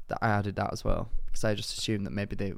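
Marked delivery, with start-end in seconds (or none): none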